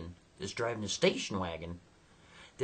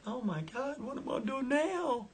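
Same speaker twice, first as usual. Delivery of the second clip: much higher, thin panicky woman's voice; panicked Becky; 11 semitones higher than usual. Retold or imitated. Stranded Becky voiced by the narrator, high panicked squeak. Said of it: "She's like".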